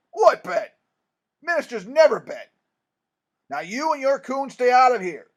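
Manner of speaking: high, very loud angry outburst; indignant shouting. A narrator's reading. Minister denying a bet and ordering boy out, shouting. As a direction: shout down the nosy questioner. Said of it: "Thurman shouted".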